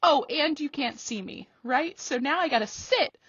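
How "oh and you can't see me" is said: higher, half-joking indignant rant; mock outrage. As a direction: act offended, tongue in cheek.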